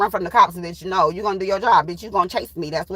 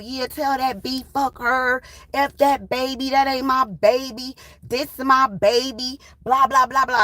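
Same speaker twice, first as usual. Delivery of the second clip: mocking higher-pitched caller voice; imitated baby daddy; 5 semitones higher, slower than usual. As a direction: drag out his phone rant, mockingly. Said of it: "He calling my homegirl's cell phone".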